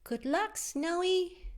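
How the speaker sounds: high, bright good-luck wish; high owl voice. Acted. Classmate wishing Al luck, high and bright. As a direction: cheer him on in a tiny voice.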